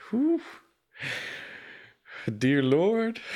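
high, breathy whew and a laughing dear Lord; flustered. Real, not acted. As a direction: fan yourself, flustered by the accent. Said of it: "I'm getting all hot and bothered over here".

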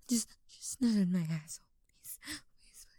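whispered begging, barely voiced; pleading whisper. Pleading with Daddy, in a hushed whisper. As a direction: beg under your breath.

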